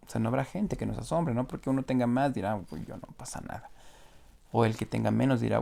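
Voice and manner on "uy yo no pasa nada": mock careless whisper. Rich man shrugging off judgment.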